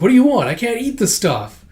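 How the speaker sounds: high-pitched, loud acted protest; exasperated. Customer refusing the cook's udon, exasperated high protest. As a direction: push back in mock indignation.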